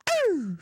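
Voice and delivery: sudden high mock yelp. Streamer reacting to a movie scene with a high yelp. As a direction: yelp like something hurt you.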